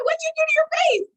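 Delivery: high, loud mock horror; retold shock. Jerry at the bus seeing Kelly's face, shocked high question.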